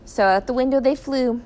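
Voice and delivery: ordinary delivery, nothing marked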